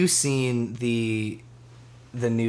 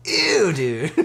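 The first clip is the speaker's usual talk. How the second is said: disgusted; 9 semitones higher than usual. Real, not acted. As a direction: recoil in mock disgust. Reacting to squirrel joke.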